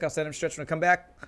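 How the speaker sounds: ordinary talk, nothing marked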